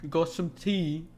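loud, exaggerated British accent with cockney slang; mock British accent. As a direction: ham up a British bloke asking for tea. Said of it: "British people, right? They're like".

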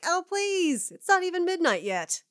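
high, exasperated pleading; theatrical high-pitched complaint; in-character protest. Acted. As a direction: scoff at an early bedtime, pitched high. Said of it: "And I'm like".

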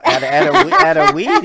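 high, loud giggling start; laughing before he can answer; words squeezed out through laughter. Real, not acted. Laughs answering how they met.

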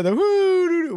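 Goofy woo-hoo, way up high; high cartoon dog yell; Goofy holler. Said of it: "We both did it anyway".

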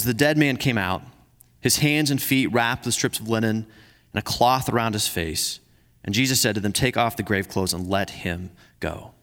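measured, earnest Bible reading; scripture reading. Acted. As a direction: read the passage like a pastor to the church.

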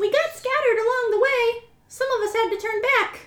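high little mouse voice, a bit wistful; squeaky mouse telling a sad tale; tiny squeaky mouse. Acted. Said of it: "I'll do my best mousy voice".

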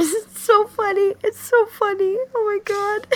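high squeaky giggling through the words; can barely speak for laughing; real helpless laughter. Real, not acted. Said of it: "if you can stop laughing".

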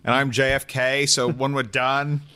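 lightly put-on Boston accent; a hint of Kennedy in Boston accent. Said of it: "With a fake Boston accent".

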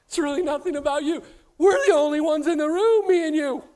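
quick, loud, laughing reply; a hammed-up giggling wife. Wife's laughing reply acted.

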